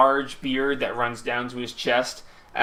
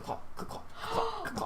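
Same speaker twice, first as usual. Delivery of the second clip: hoof clops; 15 dB quieter than usual. Acted. GM imitates hooves.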